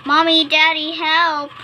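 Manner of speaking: high, loud call to parents; loud, high-pitched plea for rescue; shouted cry for help. A narrator's reading. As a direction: shout out for Mommy and Daddy. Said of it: "shouted, but there was no".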